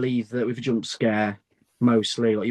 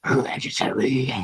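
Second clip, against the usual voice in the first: slow spooky whisper. Acted. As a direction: breathe the title like a secret. Spooky film title reveal.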